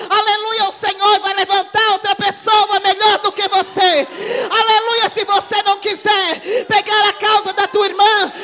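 loud, high, fervent sermon cry; fiery preaching. Real, not acted. Pastor exhorts the church, loud ecstatic preaching. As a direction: hammer the warning home to the congregation.

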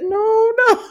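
put-on high whiny little kid; childlike whine. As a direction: whimper it like a small child. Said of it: "Cordell reverted back to childhood".

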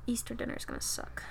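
whispered gripe. Real, not acted. Moaning about Easter dinner, whispered gripe. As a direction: whisper it glumly. Dreading the shift.

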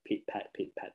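soft whispered pitter-patter; breathy rain noise, almost no voice; whispered rain sounds. Shown as a demonstration. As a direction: whisper the raindrops falling. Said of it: "about the sound of the rain".

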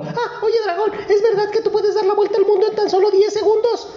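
very high, fast, with a giggle; sly princess. Acted. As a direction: flatter the dragon in a tiny giggly voice. Princess tricks dragon.

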